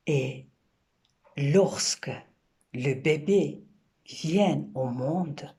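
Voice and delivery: poem recitation. Acted. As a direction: recite it gently, letting each image land. Recites her poem Comprendre.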